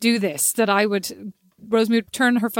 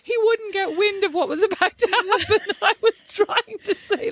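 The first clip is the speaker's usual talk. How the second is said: high, breathless laughter through the words; real giggling fit that squeezes the words; laughing helplessly; 11 semitones higher, slower than usual. Real, not acted. Retelling the failed goodnight call, still cracking up. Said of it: "I couldn't even breathe".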